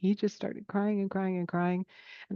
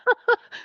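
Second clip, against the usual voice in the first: high burst of laughter; 6 dB louder than usual. Real, not acted. Laughing at her own reaction.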